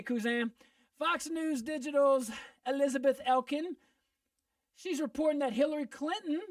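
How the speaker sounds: ordinary delivery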